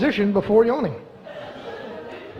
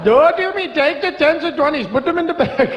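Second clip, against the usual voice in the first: mock Indian bank robber, pitched up; Indian accent; 4 semitones higher than usual. Comedian voicing an Indian bank robber, jokey Indian accent. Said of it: "if they came from India".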